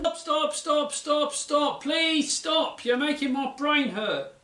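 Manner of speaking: overwhelmed plea. Acted. Kev begs Pete to stop the lecture, high pleading. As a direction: beg him to stop, brain overloaded.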